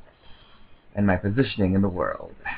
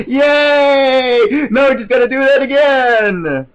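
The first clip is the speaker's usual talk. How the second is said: high, loud drawn-out whoop of joy; cheering; 8 dB louder, slower than usual. Real, not acted. Gamer celebrates finally getting in, cheering. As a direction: shriek it in triumph. Cheering after a success in game.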